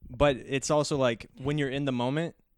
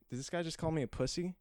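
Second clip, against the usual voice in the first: mildly baffled, higher and softer; hushed, higher-pitched mock confusion; confused; 4 semitones higher, 6 dB quieter, faster than usual. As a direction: imitate someone puzzled by an insult. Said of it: "in his confusion of being like".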